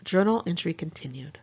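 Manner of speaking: usual speaking voice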